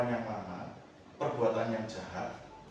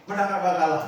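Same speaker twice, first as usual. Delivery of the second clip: laughing quote; 6 semitones higher, 12 dB louder than usual. Retold or imitated. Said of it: "ditanya kayak gini".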